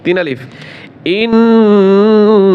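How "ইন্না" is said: slow, drawn-out chanted ghunna; Quranic recitation. Shown as a demonstration. Teacher models the recitation for students. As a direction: stretch the nasal inna long and resonant. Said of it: "কয় আলিফ টান দিতে হবে?".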